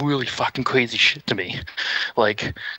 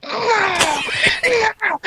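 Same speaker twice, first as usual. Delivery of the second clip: very high mock-anguished scream; raging loser; 4 dB louder than usual. Conrad on the ground after losing, anguished scream. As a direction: scream it like a tantrum over a lost match.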